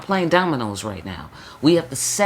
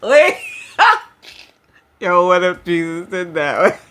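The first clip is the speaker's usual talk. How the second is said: giggling through the words; laughing; 3 semitones higher, 7 dB louder than usual. Real, not acted. Reader cracks up at her own Jesus joke, laughing. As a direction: laugh while asking it.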